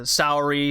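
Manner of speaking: Saori said as "sow-ree", sow as in female pig; variant pronunciation. Shown as a demonstration. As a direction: slowly say Saori with an "ow" sound. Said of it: "heard it pronounced a number of different ways".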